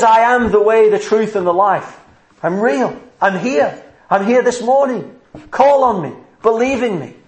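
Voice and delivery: impassioned high-pitched proclamation; crying out. Acted. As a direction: proclaim it in short, rising bursts of appeal. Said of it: "Do you hear him crying out saying".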